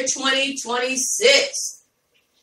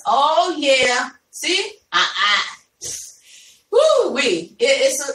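usual speaking voice

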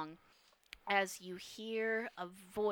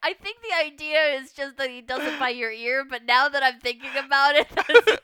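loud talk breaking into laughter; laughing; 6 semitones higher, 17 dB louder than usual. Real, not acted. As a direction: push the words out through real laughter. GM cracking up at player.

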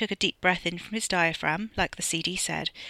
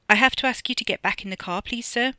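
raised, higher, polite plea; louder, deferential request; calling over; 5 semitones higher, 5 dB louder than usual. A narrator's reading. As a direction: call over anxiously but politely. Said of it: "Ollie called over".

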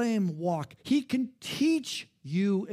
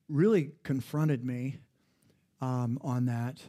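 ordinary talk

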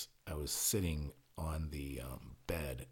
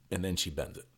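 ordinary talk, nothing marked

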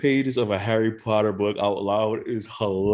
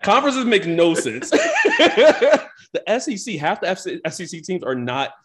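laughing; 8 semitones higher, 4 dB louder than usual. Real, not acted. Laughing rant about conferences.

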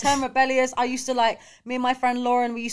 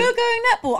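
teasing disbelief; 13 semitones higher, 3 dB louder, faster than usual. Real, not acted. Teasing friend about netball.

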